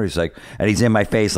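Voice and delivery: normal talking voice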